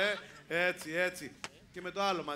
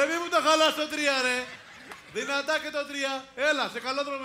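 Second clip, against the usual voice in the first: mock scold; 9 semitones higher, 7 dB louder than usual. Real, not acted. Host scolding a weak three.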